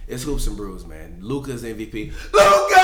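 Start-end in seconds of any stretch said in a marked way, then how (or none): 2.3-2.8 excited shout. Real, not acted. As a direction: shout the name high and loud. Hyping his MVP pick.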